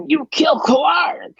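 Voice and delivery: high, slow, shouted goblin accusation; shouting goblin. Acted. Goblin guard accusing Yemek, high slow shout. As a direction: scream it at him like a wild goblin. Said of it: "the goblin on the red says".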